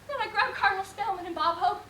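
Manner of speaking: ordinary talk, nothing marked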